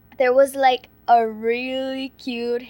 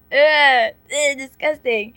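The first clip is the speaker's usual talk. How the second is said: disgusted; 3 semitones higher, 8 dB louder, slower than usual. Real, not acted. Cringing at own crush memory.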